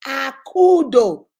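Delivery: drawn-out loud call from afar, slow; calling out a name. Retold or imitated. Late father calling his daughter back, slow loud call. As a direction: call her name long and loud so she turns back. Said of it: "he called me three times".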